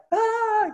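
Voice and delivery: high, loud startled "Ah"; mock overwhelmed wail. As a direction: yelp as if it all ripped off at once. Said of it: "you would be like".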